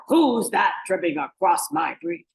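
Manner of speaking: loud troll challenge; booming, indignant storybook troll; roaring troll. Acted. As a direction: roar it from under the bridge. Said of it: "The troll roared".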